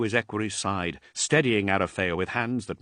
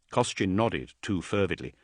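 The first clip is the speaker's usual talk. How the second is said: ordinary delivery, nothing marked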